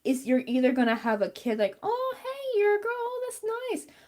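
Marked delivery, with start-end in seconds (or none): 1.8-4.0 put-on high, sing-song kid greeting; high mocking little-kid voice. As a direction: imitate a goofy kid saying hi.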